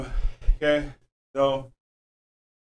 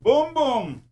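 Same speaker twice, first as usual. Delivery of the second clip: high mock announcer boom; playful high boom; 14 semitones higher than usual. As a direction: pop it out high and bright. Playful exclamation.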